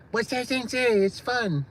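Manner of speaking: high-pitched passerby piping up; curious onlooker's high voice; imagined curious passerby. Pretend. Voices curious people driving past.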